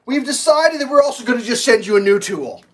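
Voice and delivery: hammed-up customer service voice; mock rep voice. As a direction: play the clueless cheerful rep. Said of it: "they're like".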